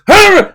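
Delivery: sudden high, loud scream; mock yell. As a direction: imitate the wife's startling outburst. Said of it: "normal tone, and she just".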